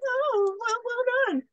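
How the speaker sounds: high, cooing crowd approval; mock admiring crowd. Crowd admires the gun trick.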